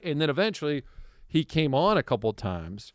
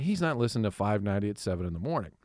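quiet, fast brush-off; dismissive; 5 semitones lower, 8 dB quieter, faster than usual. Retold or imitated. Reassuring himself about Tony, quiet and fast. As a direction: toss it off under your breath. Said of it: "And I'm like".

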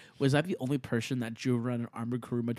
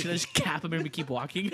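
normal talking voice

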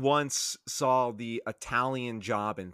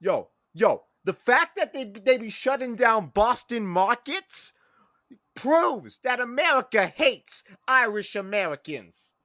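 Boston bro; 6 semitones higher than usual. Acted. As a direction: rant it up high like an aggrieved bro.

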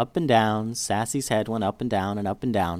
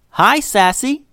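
high, loud hello to a dog; excited greeting; 11 semitones higher, 6 dB louder, slower than usual. A narrator's reading. Jessica arriving and greeting Sassy, excited. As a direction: greet the dog brightly, pitched way up.

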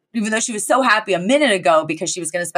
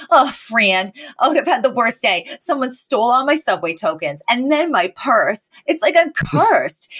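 higher-pitched, hammed-up lament; woeful complaint; 5 semitones higher than usual. Val moaning to Fran about her worst day, woeful complaint. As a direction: build to a dragged-out wail on cursed.

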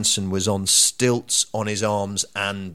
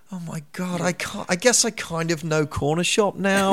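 starstruck excitement; 9 semitones higher than usual. Retold or imitated. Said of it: "I'm like".

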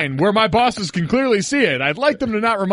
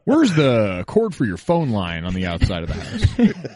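slow, low creepy stalker voice; 9 semitones lower, slower than usual. Pretend.

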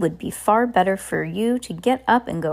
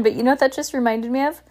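ordinary delivery

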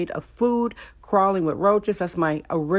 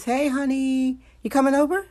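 high, cooing animal voice; 7 semitones higher than usual. Pretend. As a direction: coax it like a pet talking back. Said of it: "Here's my animal voice".

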